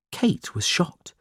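ordinary delivery

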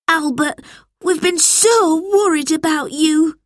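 put-on high, relieved-anxious boy's voice; high, emphatic child's exclamation; worried cry. A narrator's reading. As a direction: cry out to your lost brother. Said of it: "cried George".

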